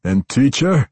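amused, leering question; sly smile. A narrator's reading. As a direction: ask it with a cruel smile. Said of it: "he asked with a smile".

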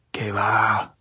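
ordinary delivery, nothing marked

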